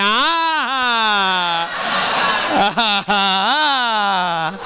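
hammed-up wail of despair, fading into slow sobs; a comic pained yell stretched into slow broken sobs; mock anguished cry. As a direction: cry out in comic dismay, then drag it out. Groans at being asked to pay.

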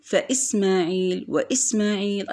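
فإسماعيل، وإسماعيل with a glottal stop before the i; hamza pronounced. Shown as a demonstration. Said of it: "أيضًا نطقنا الهمزة".

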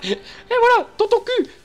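very high loud cartoon squeak; Mickey voice. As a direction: taunt in a squeaky mouse voice. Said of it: "J'ai un peu la voix de Mickey aussi".

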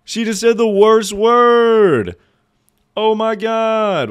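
very high, loud, slow scandalised gasp; shocked kid. Retold or imitated. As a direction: drag it out like a kid who just heard a swear. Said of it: "And I was like".

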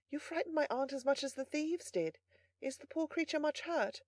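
high, quiet female voice; soft, gentle young lady. A narrator's reading. Young lady asking the butler about the wounded boy, gentle voice. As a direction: speak sweetly and softly, with concern. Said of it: "as soft and gentle as the voice".

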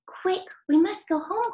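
urgent cry. Acted. Moonface urging the children home, high urgent cry. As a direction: hurry everyone off before the land leaves. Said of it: "cried Moonface".

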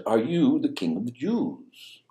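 slow, weighty Pilate question, lightly voiced; drawn-out challenge from a governor; a hint of a stern judge asking. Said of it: "he says".

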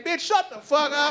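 high-pitched angry outburst; hammed-up quarrel yell; exasperated man. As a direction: cut her off in exasperation. Acted quarrel, man snapping at woman.

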